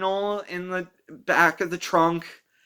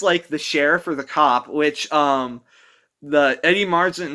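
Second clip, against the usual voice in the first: normal talking voice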